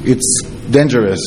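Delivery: ordinary talk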